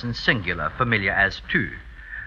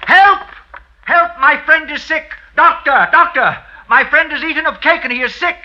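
high, loud panicked cries for a doctor; shouting for help; 12 semitones higher, 5 dB louder than usual. Acted. Servant shouting for a doctor for his sick friend, panicked. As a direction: yell for help across the house.

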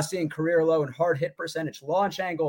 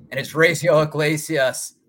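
ordinary talk

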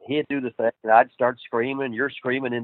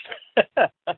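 laughing; 10 semitones higher than usual. Real, not acted. Laugh mid story.

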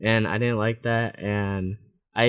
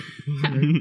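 light laugh; 4 semitones higher than usual. Real, not acted. Laughs after the inside-joke tease.

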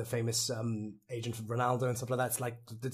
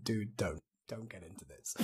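low, quiet warning aside; retold warning; 4 semitones lower, 7 dB quieter than usual. Friend warning an overexcited fan, low quiet aside. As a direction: drop the voice to warn a friend off. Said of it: "just looking at me going".

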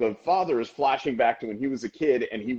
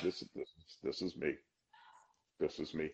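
much lower and softer, hushed; stunned recognition; 9 semitones lower, 16 dB quieter than usual. Retold or imitated. A viewer in his thirties recognising himself, lower and hushed. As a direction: say it like seeing yourself on screen. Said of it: "you're like".

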